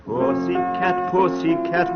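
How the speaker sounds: ordinary talk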